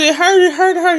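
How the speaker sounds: high, loud pained cry; mother reliving the C-section in a loud, high pained cry; retold hollering. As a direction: cry out as if it hurts badly. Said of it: "I just started hollering".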